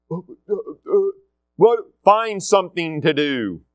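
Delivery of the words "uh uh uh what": put-on dumbfounded kid. Caught idle kids stammering.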